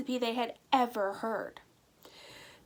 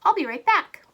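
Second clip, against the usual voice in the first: higher, louder, eager dash off; excited; 8 semitones higher, 11 dB louder than usual. Acted. Butcher to Molly, excitedly promising meat. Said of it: "said the butcher excitedly".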